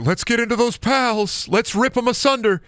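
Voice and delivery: high, punchy hype; hyped high intro. Real, not acted. Streamer redoes his greeting with high hype. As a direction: pump up the pals. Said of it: "why did I say it like that?".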